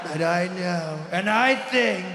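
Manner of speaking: mock Trump with wild volume and odd inflection; hammed-up Trump rambling; erratic Trump. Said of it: "volume on his voice all over the place".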